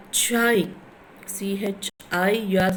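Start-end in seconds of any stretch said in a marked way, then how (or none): 0.1-0.7 "chai" punched higher and louder; stressed syllable. Shown as a demonstration. Teacher showing students where the stress falls, "chai" stressed high and strong. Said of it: "where you are stressing".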